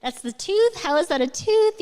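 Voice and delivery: a hint of a puzzled child asking; curious kids' question. Children at bedtime asking mom about narwhals, lightly childlike and puzzled. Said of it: "So many times it was questions like".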